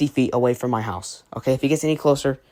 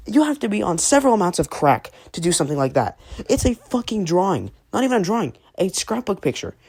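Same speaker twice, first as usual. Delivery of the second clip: ordinary talk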